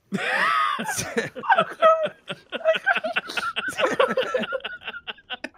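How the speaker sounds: long high shrieking laugh, real. Real, not acted. Player laughing at the crashed boat, shrieking laugh.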